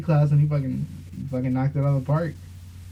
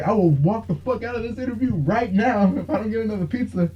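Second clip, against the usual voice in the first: mock demanding fighter, pitched higher; imitating Jorge Masvidal; 7 semitones higher than usual. Fighter demands more pizza at the interview, hammed up higher. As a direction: ham up the cocky fighter at the press conference.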